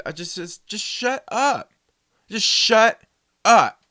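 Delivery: high and loud, slow "shut up"; loud retold shout. Repeating the shout at the movie talker. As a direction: bark the order again. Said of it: "I told him, I said".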